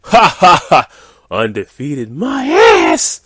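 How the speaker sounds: loud, high angry-gamer rant with a mocking laugh; mock laugh, then a slow, drawn-out yell; hammed-up raging nerd voice; Angry Game Nerd. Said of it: "I read this in the, uh, Angry, uh, Game Nerds, uh, voice".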